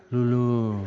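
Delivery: imitated drugged murmur of "Lulú"; sedated patient. Retold medicated patient dreaming under anesthesia, mumbling. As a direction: call it out limply, as if half asleep. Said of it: "entre sus sueños y anestesia".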